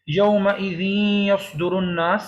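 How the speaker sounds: یومئذ یصدر with final n merged into a held nasal y; idgham with ghunnah. Shown as a demonstration. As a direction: recite یومئذ یصدر joined, humming the merged y. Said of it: "نون اور یا دونوں کی آواز نکل رہی ہے مل کر". (یومئذ یصدر کو نون اور یا ملا کر غنہ سے پڑھیں)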